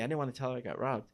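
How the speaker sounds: plain reading voice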